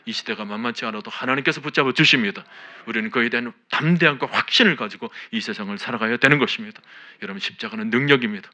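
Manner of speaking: loud, ringing preacher's exhortation; fervent sermon delivery; full-force preaching. Acted. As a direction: preach it out with conviction to the whole church. Preaching to the congregation.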